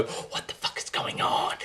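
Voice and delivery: breathy whisper of disbelief; whispered aside. Real, not acted. Ranting about Lara's reality TV show, whispered in disbelief. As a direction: drop to a stunned whisper.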